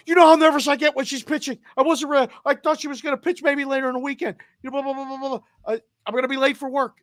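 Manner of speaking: high, fast, flustered wife rambling; nervous wife. Retold or imitated. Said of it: "She's like".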